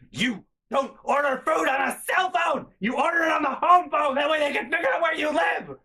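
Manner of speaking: high-pitched imitation of a screamed lecture; mom screaming. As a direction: scream it as the outraged mother. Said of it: "is screaming at me".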